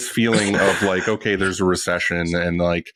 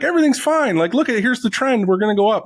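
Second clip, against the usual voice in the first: much higher, louder sing-song mockery; mock cheerful economist; 12 semitones higher, 3 dB louder than usual. Shop owner mocks the economist's rosy forecast, high and sing-song. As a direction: parody of a smug, rosy forecast. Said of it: "him just sitting up there being like".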